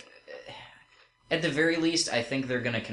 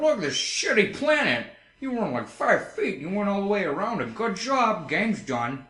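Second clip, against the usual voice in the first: high, sing-song sarcastic rant; mocking retold reaction; 9 semitones higher than usual. Retelling his play of a hated game, high sarcastic mockery. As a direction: exaggerate it in a raised mocking voice. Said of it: "And I'm like".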